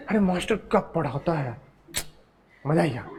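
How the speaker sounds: a soft, laughing imitation of a student; mock student praise. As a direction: play the student cheerfully praising the teacher under their breath. Teacher voices students praising him. (छात्र की हल्की हँसती नकल)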